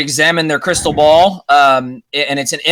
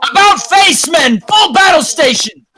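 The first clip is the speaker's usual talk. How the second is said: high, loud battle command; captain shouting orders; 14 semitones higher, 5 dB louder than usual. Acted. Captain to his men as battle starts, high commanding shout. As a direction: bellow urgent orders to the whole crew. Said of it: "you can hear the captain shout".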